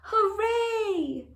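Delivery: high, loud happy cheer; girl cheering that the wolf is gone; joyful cheer. Acted. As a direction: cheer with delight.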